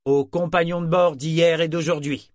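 lève son verre, plus haut et fort; toast jovial. A narrator's reading. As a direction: trinque solennellement. Brachen porte un toast. (Brachen qui porte un toast au matelot)

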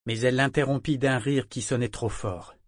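usual speaking voice

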